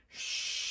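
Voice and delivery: long unvoiced shh like wind; hushing wind sound. Shown as a demonstration. For the children, wind sound on a soft shh. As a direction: breathe out the wind's hiss. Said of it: "can you make the sound of the wind".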